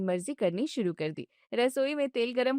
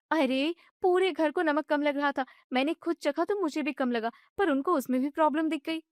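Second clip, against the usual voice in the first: higher, fast, agitated wife; upset young wife; 5 semitones higher than usual. Acted. Wife vents to her husband about his mother, agitated and high. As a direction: vent it all at once.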